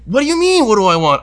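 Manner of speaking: high, loud mock outrage; exasperated comeback, played for laughs; retold indignant retort. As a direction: throw the question back, voice jumping up. Acting out quarrel at bar.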